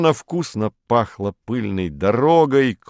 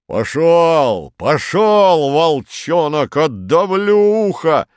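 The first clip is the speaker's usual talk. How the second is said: loud, raised jovial bellow; good-natured roar; 6 semitones higher, 4 dB louder, slower than usual. A narrator's reading. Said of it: "благодушный басистый рёв". (добродушный громкий рёв)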